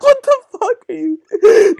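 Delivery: put-on high, slow incredulous girls; lightly voiced shocked girls; mock girls' voice. Girls reacting to the crawling boy, mock high disbelief. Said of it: "all the girls were just like".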